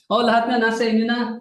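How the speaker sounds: high mock-impressed retort; very sarcastic. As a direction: imitate someone granting it sarcastically. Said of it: "Very sarcastic siya".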